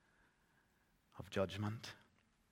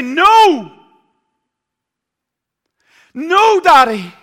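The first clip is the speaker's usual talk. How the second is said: put-on loud, high pleading child's shout; child screaming at daddy to stop; desperate child's cry; 25 dB louder, slower than usual. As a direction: cry out to stop your father. Child begs father spare lamb.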